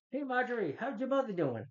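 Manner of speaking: high mock neighborly chatter; chirpy neighbor. Neighbor greeting another in a high, chirpy mock voice. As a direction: pitch it up like a cheery old neighbor. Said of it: "It's like".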